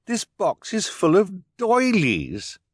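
high, slow dismayed cry. A narrator's reading. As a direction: cry it out in shock at the box. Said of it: "Violet cried".

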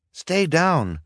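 high, loud urgent order; urgent call. A narrator's reading. Boy orders his sisters down in the smoke, urgently. Boy calls out amid the fire.

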